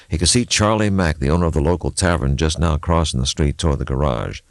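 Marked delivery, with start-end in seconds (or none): none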